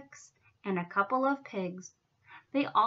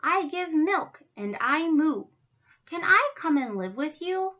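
higher, lightly voiced cow asking; hopeful cow; 5 semitones higher, 4 dB louder than usual. A narrator's reading. Miss Moo asks to live with them, hopeful. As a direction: plead lightly to be let in. Story heroine asks to stay.

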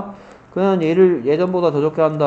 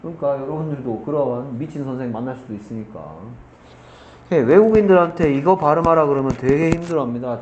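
ordinary delivery

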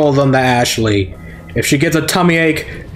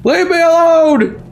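high slow protest; mock high cry. Player voicing the character pushing him away, high. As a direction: squeal it out.

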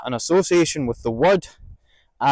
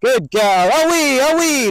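high, loud praise to the dog; encouraging shout to the dog; encouraging call; 10 semitones higher, 4 dB louder, slower than usual. Shown as a demonstration. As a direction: cheer the dog on. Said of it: "Lots of encouragement".

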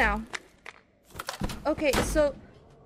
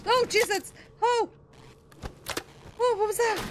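startled yelp; 8 semitones higher than usual. Real, not acted. Jump scare in horror game.

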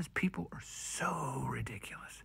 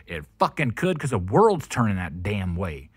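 heated outburst; 9 dB louder than usual. Real, not acted. Ranting about pollution spreading.

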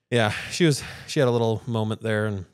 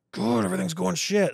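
a high, exasperated groan of a line; mock despair; 9 semitones higher than usual. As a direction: play up the gloom, hammed-up. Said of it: "sometimes I'll be like".

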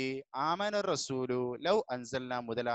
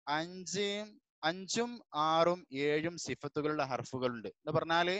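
ordinary delivery

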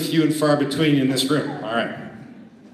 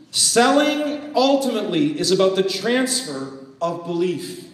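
normal talking voice

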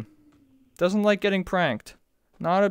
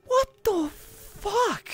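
stunned disbelief; 11 semitones higher, 6 dB quieter, much slower than usual. Real, not acted. Stunned by the game.

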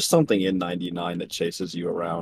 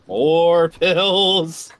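loud, drawn-out groaning complaint; exasperated shout; 9 semitones higher, 9 dB louder than usual. Real, not acted. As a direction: yell it out in disbelief. Repeats louder, exasperated.

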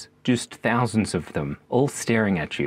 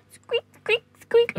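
very high squeaks; mouse squeak; slower than usual. Shown as a demonstration. Mouse squeaking while running away. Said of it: "mice who are having".

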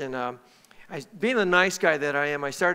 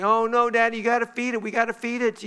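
put-on higher, urgent kid pleading; imitating begging children; 7 semitones higher than usual. Daughters begging Dad to feed the kitten, higher mock pleading. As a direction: mimic kids begging their dad. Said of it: "they begged me".

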